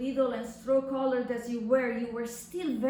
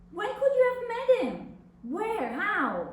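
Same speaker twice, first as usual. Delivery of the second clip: shocked disbelief; 7 semitones higher than usual. Acted. Shocked daughter questions mother's secret.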